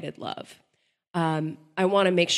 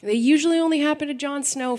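high, fast, louder amused echo; 9 semitones higher, 6 dB louder, faster than usual. Real, not acted. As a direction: echo the joke back brightly. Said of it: "echoes cohost's joke, higher and louder".

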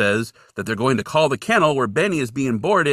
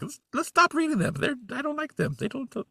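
high whiny complaint; 14 semitones higher, 7 dB quieter than usual. Retold or imitated. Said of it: "And that's when I was like".

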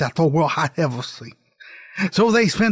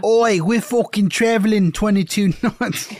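mock British; 5 semitones higher than usual. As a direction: shout it like a cockney deckhand. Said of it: "they're British, right?".

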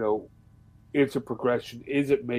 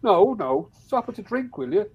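high-pitched retold objection; mock indignant protest; 9 semitones higher, 4 dB louder than usual. Irishman protesting at strangers pushing drink, mock indignation. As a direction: act out an exasperated telling-off, pitched up.

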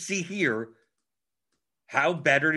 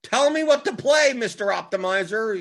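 mock pleading user; 8 semitones higher, 6 dB louder than usual. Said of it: "They think that it's gonna do".

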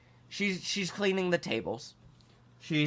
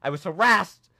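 loud, hammed-up victim reporting harassment; mock outraged complaint; 2 semitones higher, 6 dB louder than usual. Customer complaining to the manager, mock outrage. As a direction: protest loudly with mock indignation. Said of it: "go to the manager and be like".

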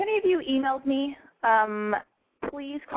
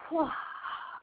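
blowing out candle; 6 semitones higher, 9 dB quieter than usual. Real, not acted. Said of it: "Hold on".

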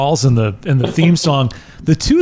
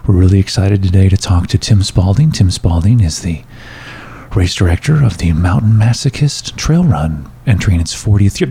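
radio host voice; 9 semitones lower than usual. Shown as a demonstration. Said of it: "the host talks like this".

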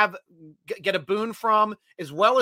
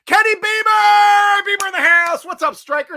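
loud, high hype greeting; big excited welcome shout; 11 semitones higher, 8 dB louder than usual. Real, not acted. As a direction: call out his name like he just walked in. Greeting a chat viewer loudly.

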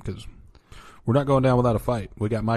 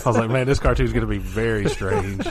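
talking through laughter; laughing; 5 dB louder than usual. Real, not acted. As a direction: laugh the words out.

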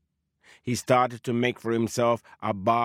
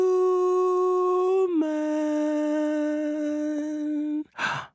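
eerie gasp. Acted. Spirit voice in the woods.

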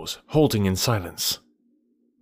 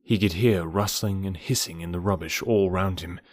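plain reading voice

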